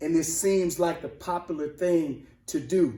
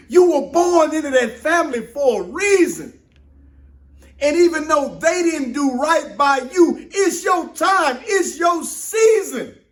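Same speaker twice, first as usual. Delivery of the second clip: rising, louder preacher's build; preaching at full force; 9 semitones higher, 5 dB louder than usual. Real, not acted. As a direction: preach it out, slowing on the last words. Preaching to the congregation.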